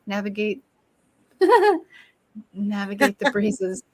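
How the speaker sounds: flustered, laughing through words; nervous laugh. Real, not acted. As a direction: laugh nervously while repeating. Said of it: "that was a nervous laugh".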